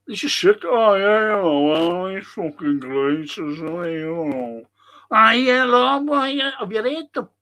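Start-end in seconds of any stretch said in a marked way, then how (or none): 0.6-4.7 a hint of a put-on posh English accent; lightly mock a posh English accent. Mocking pundits in a hammed-up posh English accent. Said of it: "Fucking put on the accent". 5.1-6.5 high, loud mock posh English accent. Mocking pundits in a higher, louder posh English accent. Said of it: "Fucking put on the accent".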